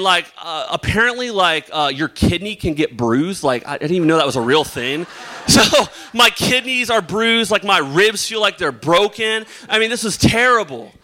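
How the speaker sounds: normal talking voice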